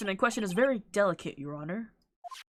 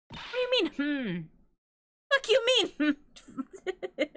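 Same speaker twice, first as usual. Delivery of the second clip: mock squeaky taunt; 10 semitones higher, much slower than usual. Gamer mocking a character's line, squeaky high taunt. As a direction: pitch way up, mocking and nasal-free, sneering.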